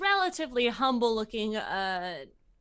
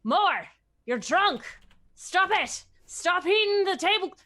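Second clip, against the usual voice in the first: much higher, louder yelling at the cow; scolding the cow; 9 semitones higher, 7 dB louder than usual. Acted. Farmer yelling at his cow in front of everyone. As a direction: shout down a misbehaving animal. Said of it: "he just says".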